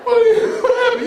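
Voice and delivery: high, laughing mock astonishment; amazed teacher. A student mocking a teacher surprised to see him, squealing. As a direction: squeal it like you can't believe who showed up. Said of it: "you'd be like".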